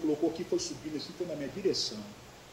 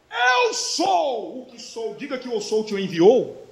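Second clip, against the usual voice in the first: booming divine declaration, opening high and slow; God's proclamation; 3 semitones higher, 7 dB louder, much slower than usual. Acted. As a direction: proclaim it slowly as the voice of the Lord. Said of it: "Aí o senhor falou com ele assim". (voz de Deus, proclamando com força)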